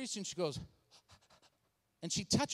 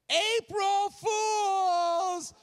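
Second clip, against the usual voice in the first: high, loud, long-drawn holler; acted prank reveal shout; 9 semitones higher, 6 dB louder, much slower than usual. Pranksters to pastor on April Fools, drawn-out gleeful shout. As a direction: stretch each word into a gleeful shout.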